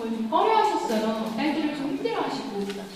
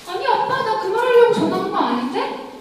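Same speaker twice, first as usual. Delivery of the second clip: surprised protest; 7 semitones higher, 5 dB louder than usual. Retold or imitated. Daughter protests to father on phone.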